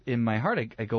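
ordinary talk

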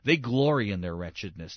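ordinary talk, nothing marked

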